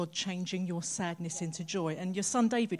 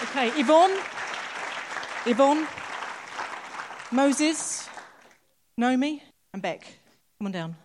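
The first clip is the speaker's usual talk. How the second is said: loud, higher call of names across the room; 7 semitones higher, 8 dB louder than usual. Real, not acted. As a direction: call them up to the front. Host calling people forward.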